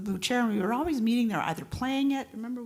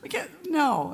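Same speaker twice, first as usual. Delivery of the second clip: much higher, slow, playful refusal; mock protest; 11 semitones higher, slower than usual. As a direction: squeal it high and stretch it out.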